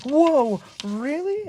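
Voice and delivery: high-pitched wide-eyed wonder; hammed-up awe; mock amazement. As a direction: gasp it out high, like an amazed kid. Buddy amazed in retelling.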